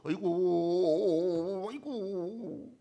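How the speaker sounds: very slow drawn-out mock lament; ritual wailing. Mourners forced to wail for the crowd, drawn-out lament. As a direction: stretch each aigo into a wail. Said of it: "또 곡을 해요".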